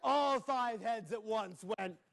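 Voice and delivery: ordinary delivery, nothing marked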